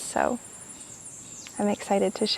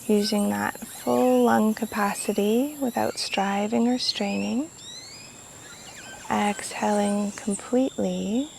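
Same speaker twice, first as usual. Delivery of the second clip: guided meditation; 4 dB quieter than usual. Real, not acted. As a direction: guide them gently through each breath, unhurried. Leads a guided breathing meditation.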